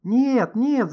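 high, loud cry; shouted protest. A narrator's reading. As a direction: cry out in urgent denial. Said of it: "закричал Никита".

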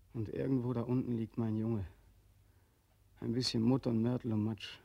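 normal talking voice

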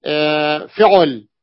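بفعل drawn out very slowly and leaned on; stretched word. Shown as a demonstration. Teacher pointing out the missing pattern, very slow. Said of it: "الآن لم يأتي".

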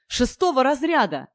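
a touch of breathless eagerness; eager, overjoyed. A narrator's reading. Overjoyed hero keeps exclaiming.

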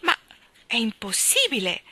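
a touch breathless with disbelief; gasping exclamation. A narrator's reading. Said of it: "esclamò il gran cancelliere boccheggiando".

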